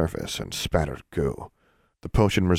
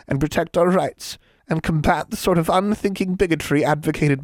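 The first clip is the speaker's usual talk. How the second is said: high-pitched self-righteous lecture; indignant, officious hag; 10 semitones higher, 3 dB louder than usual. A narrator's reading. Hag lectures a boy about hurtful jokes, indignant. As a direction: lecture them like an offended official.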